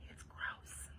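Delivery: whispered disgusted aside; hushed aside. Real, not acted. Reader comments on spitting to listeners, whispered. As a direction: drop to a whisper for a private comment.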